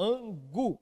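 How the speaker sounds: "angu" split slowly, weight on gu; stress on final syllable. Shown as a demonstration. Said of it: "é esse gu aí do final". ("angu" destacando o gu final)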